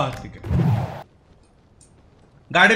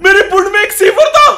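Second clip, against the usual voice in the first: high, loud, rapid exclamation; startled outburst; 8 semitones higher, 6 dB louder, faster than usual. Real, not acted. Gamer discovering an enemy behind him, startled outburst. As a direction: blurt it out in alarm.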